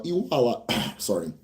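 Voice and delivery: ordinary talk, nothing marked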